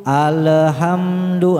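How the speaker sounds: "alhamdu" slow, with a vowel let slip after the l; wrong bouncing l. Shown as a demonstration. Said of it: "Ah, itu juga nggak".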